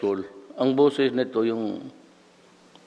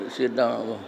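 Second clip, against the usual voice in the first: ordinary delivery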